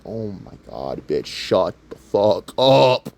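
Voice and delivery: slow, low mock exasperation; fed-up partner. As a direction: imitate a long-suffering boyfriend's slow groan. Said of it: "he's just sitting there going".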